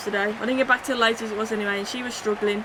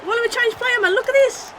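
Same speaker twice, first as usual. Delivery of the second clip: frustrated outburst; 13 semitones higher, 6 dB louder than usual. Real, not acted. Annoyed at the game's controls.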